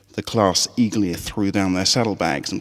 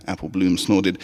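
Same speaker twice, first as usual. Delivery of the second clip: plain reading voice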